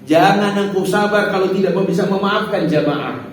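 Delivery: ordinary talk, nothing marked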